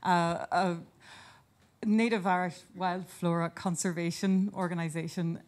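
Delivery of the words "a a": ordinary talk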